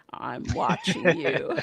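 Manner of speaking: slow gravelly monster voice, laughing. Retold or imitated. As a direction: growl it out slowly. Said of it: "the gravelly voice".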